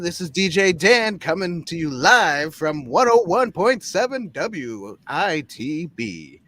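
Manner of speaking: swinging radio DJ station ident; playful DJ patter. Pretend. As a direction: announce it live on air, drawing out the call letters. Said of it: "Dan looks like a D J".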